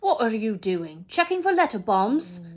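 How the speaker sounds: loud, jeering taunt; mocking uncle. Uncle taunting the boy about his letter, loud and jeering. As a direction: sneer the joke at him.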